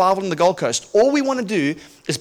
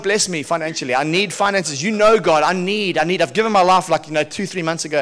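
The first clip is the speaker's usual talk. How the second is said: ordinary talk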